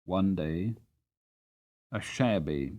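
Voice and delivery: usual speaking voice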